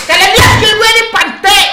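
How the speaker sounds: loud, high bragging; boastful shout. Real, not acted. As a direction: show off your skill loudly. Boasting after kills.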